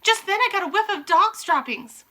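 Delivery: high, loud excited witch; sniffing witch alerts the others. Acted. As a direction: report the smell urgently. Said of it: "she yelled".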